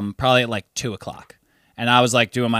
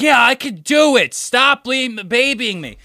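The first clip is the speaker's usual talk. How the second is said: put-on high drunk protest; 10 semitones higher, slower than usual. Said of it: "She's like".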